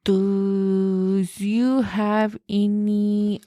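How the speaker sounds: very slow, drawn-out request; mock a dim shopper; clueless customer. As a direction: stretch every word slowly. Imitating a clueless music customer.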